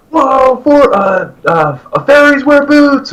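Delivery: high, loud, drawn-out fan shout; excited shout. Retold or imitated. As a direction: yell the song title at the stage. Shouting Sabbath song at show.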